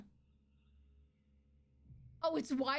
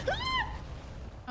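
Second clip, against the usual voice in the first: sudden yelp. Real, not acted. Hit by hated move.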